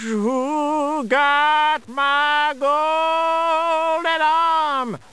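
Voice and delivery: very slow, high drawn-out ghost moan; ghostly wail. Acted. Dead wife's ghost moaning through the wind, very slow and high. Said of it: "he hear a voice all mixed up in the wind".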